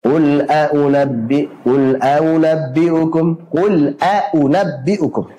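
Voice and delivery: a'unabbi'ukum slow, hamzah complete; full tahqiq hamzah. Shown as a demonstration. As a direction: articulate each hamzah in a'unabbi'ukum. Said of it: "hamzah dengan tahqiq, dengan jelas".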